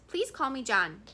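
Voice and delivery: plain reading voice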